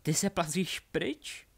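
high, slow, jeering question; mocking taunt. Delphi mocks Harry crawling away, jeering. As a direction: taunt him, drawing it out high. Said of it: "smála se mu".

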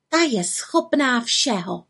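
slow, mildly grumbled remark; grumbling. A narrator's reading. Little witch complains about her aunt, mildly grumbling. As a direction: grumble it slowly, only lightly put on. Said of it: "zabručela malá čarodějnice".

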